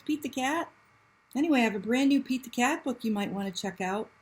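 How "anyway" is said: ordinary delivery, nothing marked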